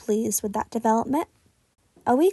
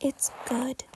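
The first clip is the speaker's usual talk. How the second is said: soft, shy whisper; barely audible mumble of praise; 8 dB quieter than usual. A narrator's reading. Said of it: "he whispered".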